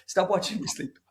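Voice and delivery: quoted through a laugh; laughing while imitating the protest; laughing quote. Retelling the sleep scene to a friend, laughing through it. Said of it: "He's like".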